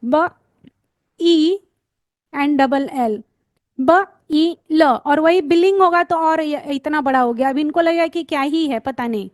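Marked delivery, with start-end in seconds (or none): 0.0-4.8 ब, ई, ल said slowly apart with pauses; slow sounding-out for kids; broken into sounds. Shown as a demonstration. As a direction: break बिल into ब ई ल. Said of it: "just break the words like this".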